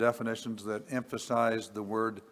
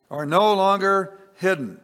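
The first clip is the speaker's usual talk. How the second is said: higher and louder, driving "no" home; emphatic repeat; 8 semitones higher, 8 dB louder than usual. Acted. Preacher repeating the verse to congregation, louder and higher. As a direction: proclaim it the second time with force.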